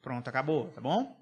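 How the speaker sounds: ordinary talk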